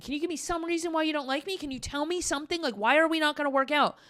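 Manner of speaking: high-pitched mock begging for answers; imitated pleading. Retelling girlfriend's pleading questions.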